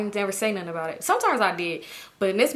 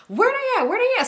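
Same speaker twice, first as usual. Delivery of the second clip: very high, louder repeated demand; frantic Toya; 4 dB louder than usual. Retold or imitated. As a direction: squeal it like a panicked, suspicious girlfriend. Toya insisting, rising urgency.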